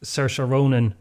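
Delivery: usual speaking voice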